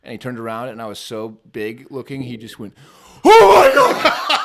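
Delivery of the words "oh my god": sudden loud, high, drawn-out shriek; startled dad's scream. Retold or imitated. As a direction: imitate a man shocked out of his wits. Startled dad sees giant costume.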